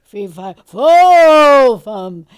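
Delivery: lifting-a-desk bellow on "fo"; voice coach's booming exercise. Retold or imitated. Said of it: "Then you go".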